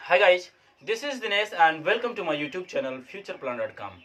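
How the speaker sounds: slow channel intro. Real, not acted.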